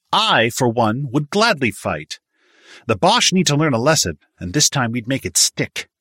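volunteer with keen, emphatic bravado; eager young man. A narrator's reading. Handsome young man says he would gladly fight, eager and high.

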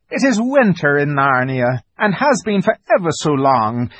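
high fawn voice, slow and ceremonious; Tumnus, courtly invitation. A narrator's reading. Fawn invites Lucy to tea, high courtly voice. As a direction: invite with grand, drawn-out formality.

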